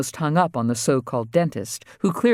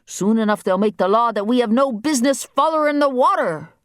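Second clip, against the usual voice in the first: high-pitched island dialect, complaining; indignant island waterman boy; 10 semitones higher than usual. Acted. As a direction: grumble high in a rural island accent. Voices Fonny Boy.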